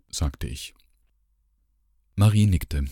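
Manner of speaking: plain reading voice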